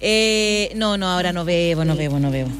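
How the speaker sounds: ordinary delivery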